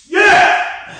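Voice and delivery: loud, high victory whoop; triumphant yell. Real, not acted. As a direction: celebrate the set out loud. Cheer after finishing set.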